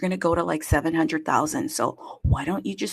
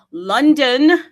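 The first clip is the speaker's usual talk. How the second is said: loud, high shout of the city name; calling out; 8 semitones higher, 12 dB louder than usual. Acted. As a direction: call London in like a roll call. Calling cities into the space.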